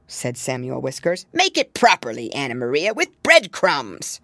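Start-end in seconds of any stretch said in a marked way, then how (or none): 1.3-4.2 put-on a fussy old rat insisting. Samuel Whiskers insists.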